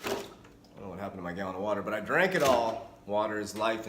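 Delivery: ordinary delivery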